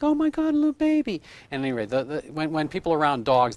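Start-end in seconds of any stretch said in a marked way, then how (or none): 0.0-1.2 imitating mother's baby-talk. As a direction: voice jumps up very high, cooing imitation. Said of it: "their voice goes up a few notches".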